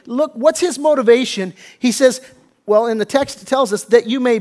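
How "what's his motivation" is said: usual speaking voice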